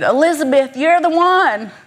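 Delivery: a friend's Alabama accent, retold with a smile. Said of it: "Sorry for the Alabama accent".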